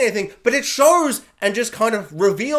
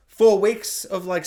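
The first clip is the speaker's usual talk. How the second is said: normal talking voice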